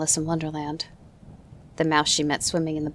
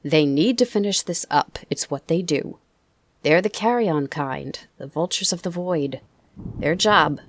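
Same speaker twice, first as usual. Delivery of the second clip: louder, cold South African persuasion; sharp South African accent; 6 dB louder than usual. Acted. Opal miner urging the boy to surrender, louder South African accent. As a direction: coax him to give up in a South African accent. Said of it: "in his sharp South African accent".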